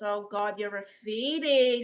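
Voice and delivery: usual speaking voice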